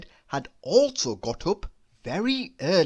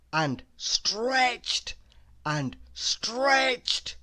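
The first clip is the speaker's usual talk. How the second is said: stretching higher; 5 semitones higher, much slower than usual. A narrator's reading. Stretching built up.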